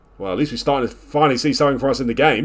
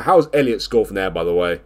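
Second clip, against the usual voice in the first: ordinary talk, nothing marked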